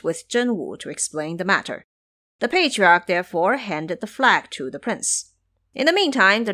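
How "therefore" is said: usual speaking voice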